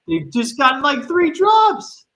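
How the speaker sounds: drawn-out high, loud disbelief. Real, not acted. Streamer watching Minnesota drop the disc, stunned high disbelief. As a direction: stretch it out, stunned by the drops.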